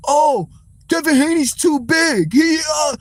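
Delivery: mocking whine. Streamer mocking fans who call Haney too big, half-shouted sneer. Mocking critics' excuses for Tank.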